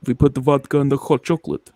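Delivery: put-on comic Russian accent with hard consonants. Friend asked for Russian, mock Russian accent. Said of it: "Can you do Russian though?".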